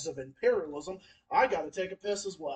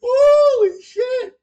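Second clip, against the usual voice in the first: slow, much higher, much louder cry of disbelief; shocked scream; 17 dB louder, much slower than usual. Real, not acted. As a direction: scream it out in real shock, stretched. Shocked by friend's dark remark.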